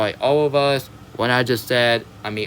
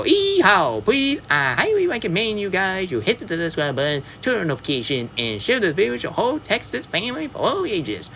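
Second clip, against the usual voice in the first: high, hammed-up Texan cowgirl voice, yeehaw and all; high-pitched mock Texas accent, playful; Sandy Cheeks voice; 6 semitones higher than usual. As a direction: play a Texas squirrel hyping her fans, much higher. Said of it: "I'm very sorry I sound like Sandy Cheek".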